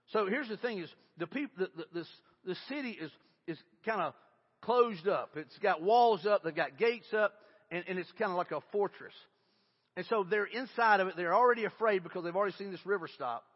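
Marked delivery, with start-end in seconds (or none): none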